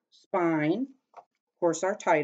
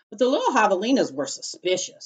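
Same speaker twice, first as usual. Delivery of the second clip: usual speaking voice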